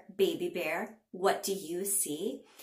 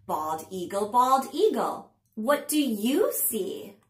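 slow, sing-song picture-book reading; punchy, drawn-out storytime narration; read-aloud to children; 5 dB louder, slower than usual. A narrator's reading. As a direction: read it to little ones with big pitch swings and pauses.

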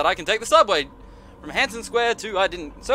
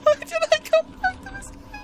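whiny voice. Real, not acted. Whining after a scare.

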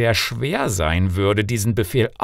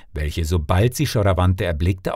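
plain reading voice

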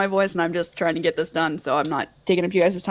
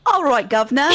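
higher, louder hammed-up British accent; cheeky put-on British accent, pitched up; mock British accent; 4 semitones higher, 5 dB louder, much faster than usual. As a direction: do a joky British "governor" greeting. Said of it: "kind of like a posh British accent".

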